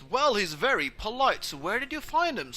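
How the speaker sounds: high, loud, pleased dad; gushing, swooping fatherly approval; oblivious dad. Acted. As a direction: take it as a real compliment, loudly. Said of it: "doesn't pick up the mock part".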